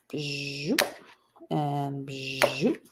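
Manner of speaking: low soft zoop; zoop sound effect. Pretend. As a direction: breathe the zoop like a blade.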